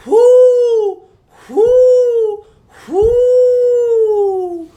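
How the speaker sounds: high, loud, drawn-out owl hoots; long falling "whoo" of an owl. Pretend. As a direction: hoot like an owl, ringing out high. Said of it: "What is the sound that an owl makes?".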